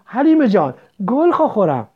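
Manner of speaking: a stunned, aching cry of her name; dazed moaning call. A narrator's reading. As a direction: call out to your lost sister as if in a dream. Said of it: "با صدایی غایب و حیران نالید".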